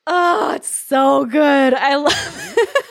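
high giggly laughter through words; laughing. Real, not acted. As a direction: laugh while talking. Both laughing at story.

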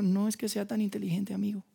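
a quiet private thought; inner thought, hushed. Retold or imitated. Counselor thinking to himself, hushed. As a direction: say it softly to yourself. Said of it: "tu psicólogo está pensando".

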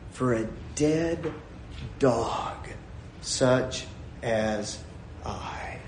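slow, soft, halting self-abasement; abjectly humble. Acted. Servant bowed before the king, humble and fading. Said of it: "Oh, is this guy low?".

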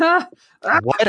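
high, shocked what; stunned what. Real, not acted. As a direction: react as if you can't believe it. Stunned at a friend's reveal.

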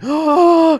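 high, loud mock "oh"; imitated awed gasp. Retelling how the whole audience reacts, mock awe. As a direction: gasp in exaggerated amazement.

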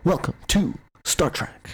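slow, halting, lightly voiced Shatner; William Shatner impression. Host imitating Shatner's halting delivery for cohosts. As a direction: space the syllables out like Shatner. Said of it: "Shatner does it".

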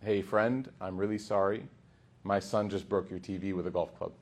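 low, quiet, offhand apology; casual confession. Retold or imitated. Guest owning up to the host about his son, casually. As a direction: break bad news as if it were nothing. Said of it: "and casually says".

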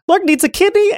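high, scandalized creature voice; gasping shock. Acted. Left kidney learning brother needs a kidney, high shock. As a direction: exclaim it high after a gasp. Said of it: "immediately gasps and goes".

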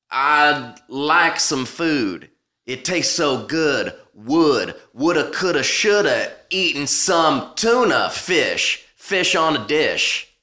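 slow, punched slam-poet rhythm; mock-earnest poetry slam; improvised slam poetry. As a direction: chew each rhyme, pausing between phrases. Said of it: "I'll role play here".

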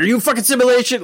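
fast, high mock threat; Rick. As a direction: rattle off a sudden high-pitched accusation. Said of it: "like, threatening Morty".

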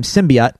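"symbiote" said SIM-bee-ot; low sim-bee-ot for "symbiote"; habitual variant pronunciation. Shown as a demonstration. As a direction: end "symbiote" on a short OT, not oat. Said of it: "as I've said it for a long time".

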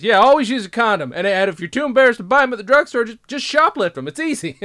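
loud, high character voice; hammed-up advice giver. Host plays character giving condom advice, loud and high. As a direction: dish out bad advice at full volume.

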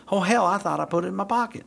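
high, fast Southern handler imitation; Southern accent. Handler finding his mistake, surprised Southern accent. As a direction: exclaim it in a put-on Southern twang. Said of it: "a Southern accent that's gonna be pretty sweet".

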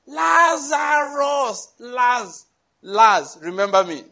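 hammed-up bellow, mocking a noisy preacher; mock shout. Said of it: "Don't think he shouted".

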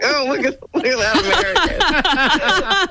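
hammed-up onlooker voice breaking into laughs; laughing mock onlookers. Imitating onlookers pointing out an American.

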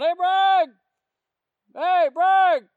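high, loud holler from far off; yelling. Retold or imitated. Stranger hailing an officer at a traffic stop, yelling. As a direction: yell across the dark to get attention. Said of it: "who is yelling at me?".

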